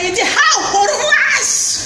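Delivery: ordinary delivery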